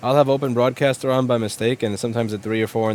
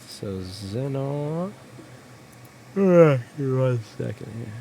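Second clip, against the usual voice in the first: yawning; slower than usual. Real, not acted. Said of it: "Excuse me for all the yawning".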